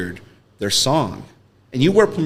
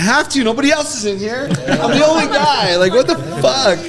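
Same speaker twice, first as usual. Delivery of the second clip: much higher pitched, defensive whine; higher mock-exasperated self; 14 semitones higher, 3 dB louder than usual. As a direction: protest like a lonely DJ. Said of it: "I'm like".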